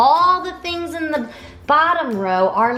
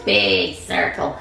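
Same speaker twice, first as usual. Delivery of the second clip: much lower, deep big-ape voice; 5 semitones lower than usual. Shown as a demonstration. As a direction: drop it down low for the big ape. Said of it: "say hi with a big voice".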